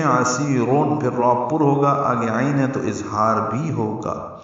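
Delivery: ordinary talk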